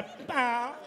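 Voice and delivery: a lightly voiced stutter on Papa; stuttering cop. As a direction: stammer through the name. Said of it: "a cop with a stutter".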